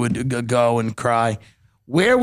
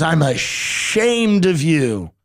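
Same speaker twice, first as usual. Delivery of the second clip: slow, drawn-out grandmotherly shaming; higher, drawn-out mock scolding; 4 semitones higher, much slower than usual. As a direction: imitate a grandmother stretching out every word of her reproach. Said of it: "my grandmother was with me, and she goes".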